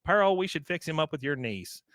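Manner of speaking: put-on high, doting old-lady voice; imitated fond tea-room lady. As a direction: gush over a charming young guest. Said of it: "the old ladies are talking to Bobby".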